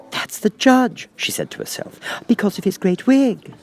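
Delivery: put-on high, light girlish voice; lightly high Alice wishing. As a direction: voice young Alice, a little impatient. Alice's voice in read story.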